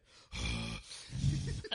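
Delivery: loud, low mock huff of rage; loud angry huff. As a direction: huff loudly through the nose like a furious friend. Imitating Josh huffing angrily.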